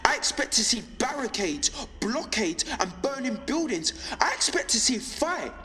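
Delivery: rising, fervent high-pitched rallying speech; impassioned. Acted. Broadcaster picturing the uprising they expected in the streets, impassioned. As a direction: build insistently through each repeated expectation to a fiery peak.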